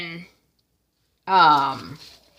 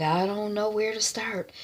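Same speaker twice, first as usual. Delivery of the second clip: much softer, deflated reply; subdued reply; 9 dB quieter than usual. Retold or imitated. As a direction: say it drained. Retold heartbroken reply.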